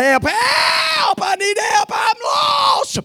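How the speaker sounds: very high, drawn-out holler; sustained high shout for rescue; cry for help. Acted. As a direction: call out long and high, as if lost in the woods. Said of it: "you're gonna cry".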